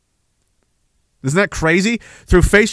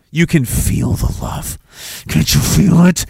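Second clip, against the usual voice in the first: slow, whispery and insinuating; mock loving; slower than usual. Sarcastically preaching love to the viewers, breathy mock loving. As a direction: breathe it out with fake tenderness.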